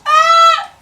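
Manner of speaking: very high, loud shriek; hammed-up high scream; imitated death scream. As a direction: scream as if being vaporized. Her scream as she vaporizes.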